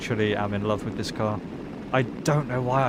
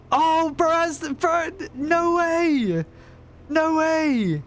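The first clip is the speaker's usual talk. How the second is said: excited; much slower than usual. Real, not acted. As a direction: squeal like a hyped frat bro. Sees friend's new car.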